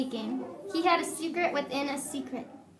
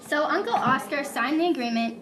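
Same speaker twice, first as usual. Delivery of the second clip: ordinary talk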